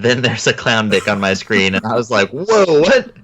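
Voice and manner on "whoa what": high startled whoa; shocked double take; surprised. Retold or imitated. Reacting to clown on screen.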